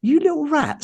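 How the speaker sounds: high-pitched mock outrage; mock indignation. Dad hiding in wardrobe hears their plan, mock indignation. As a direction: squeal it in playful disbelief, retelling. Retelling his reaction from the wardrobe.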